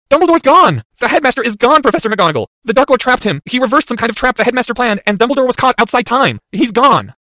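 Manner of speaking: high, loud, desperate; distraught outburst. Acted. Boy telling the professor Dumbledore is lost, distraught. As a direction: cry out the bad news urgently.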